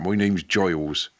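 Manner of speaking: put-on country yokel accent, "Giles" said like "Joyles"; rustic accent. Host mocks a farmer giving his name, rustic accent. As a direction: imitate a rustic farmer introducing himself as "Giles", oy vowel. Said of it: "someone who talks like that".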